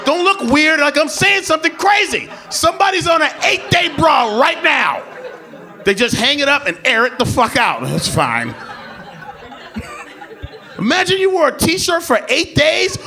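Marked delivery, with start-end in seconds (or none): none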